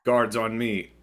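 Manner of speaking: loud order to the guards; commanding call. Acted. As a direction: bark a rallying command. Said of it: "he goes".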